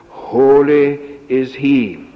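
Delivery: "Holy" drawn out and weighty; stress on Holy. Shown as a demonstration. Preacher quoting verse five, slow stress on "Holy". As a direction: give "Holy" the weight, slowly, then settle. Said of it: "The emphasis is on the word holy".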